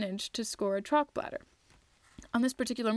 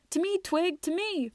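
high, raised call to a teammate; 8 semitones higher than usual. A narrator's reading. Teammates calling Twig to pass, high and raised. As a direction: shout for the ball across the field. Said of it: "called out".

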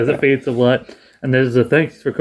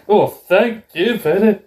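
mock prim thanks; 7 semitones higher, slower than usual. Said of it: "then you went".

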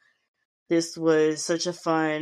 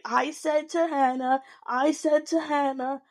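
lightly voiced, higher repeated insistence; a hint of an indignant cast member on repeat; mocking Tanisha; 9 semitones higher than usual. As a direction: parody her saying it over and over. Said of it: "I think she said, like, fifteen times".

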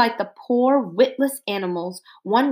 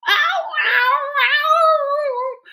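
high screaming yowl; screaming cat; 3 dB louder, much slower than usual. A narrator's reading. Frightened cat unable to talk, screaming and yowling high. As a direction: caterwaul like a terrified cat. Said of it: "screamed the cat".

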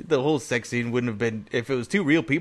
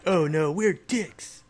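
slow, higher, drawn-out quote; mock movie speech; 6 semitones higher, slower than usual. Co-host quoting the speech for friends, mock dismay. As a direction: stretch each word in mock dismay.

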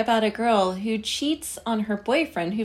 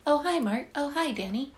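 high-pitched mock-creepy hello; creepy Johnny greeting; 7 semitones higher than usual. Mocking Johnny's "oh, hi" to his friends, mildly creepy. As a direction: lightly imitate an oddly creepy greeting. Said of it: "it's just creepy".

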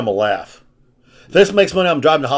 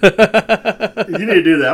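slow words through laughter; laughing; 5 semitones higher, 3 dB louder, much slower than usual. Real, not acted. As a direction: say it while cracking up.

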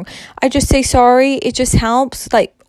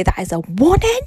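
accidental voice rise. Real, not acted. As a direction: let "warning" shoot upward at the end. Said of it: "I wasn't meant to go like that".

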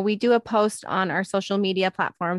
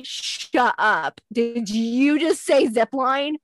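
drawn-out, hammed-up incredulity; joking disbelief; 3 semitones higher, 3 dB louder, much slower than usual. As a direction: imitate a kid playing amazed, stretching the words. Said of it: "trying to, like, be funny and say".

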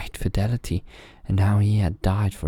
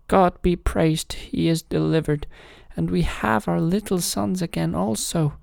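joyful king; 12 semitones higher than usual. A narrator's reading. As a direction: celebrate the rescue. King rejoices.

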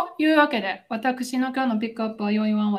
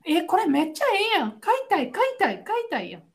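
eager customer; 7 semitones higher than usual. Retold or imitated.

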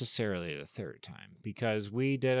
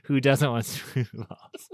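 higher, louder, slow, amused; laughing echo; 4 semitones higher, 7 dB louder, slower than usual. Real, not acted. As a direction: repeat it laughing, drawn out. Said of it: "echo with laugh".

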